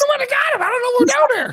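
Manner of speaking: high-pitched, rapid mimicry; mocking fast talker. Co-host teasing guest about talking fast, mocking imitation. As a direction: rattle it off high, running words together. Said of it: "when you get talking really fast".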